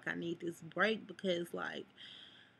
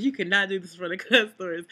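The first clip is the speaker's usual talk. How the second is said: firm loud warning; 10 dB louder than usual. Retold or imitated. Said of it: "I'm like".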